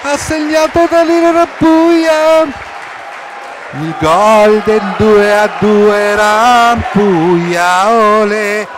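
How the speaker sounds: high, loud, drawn-out goal shout; breathless italian goal commentary. Real, not acted. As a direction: scream the goal name, stretching it. Goal scored, commentator erupts.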